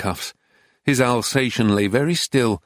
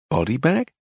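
puzzled, rising on bag; puzzled question. A narrator's reading. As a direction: ask it back in mild disbelief. Narrator questions the title.

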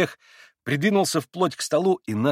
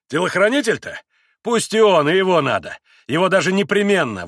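loud, high, hearty assent; exclaiming; 6 semitones higher, 6 dB louder than usual. A narrator's reading. Mitya welcoming the bodyguard too, loud exclamation. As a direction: exclaim it emphatically. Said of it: "воскликнул Митя".

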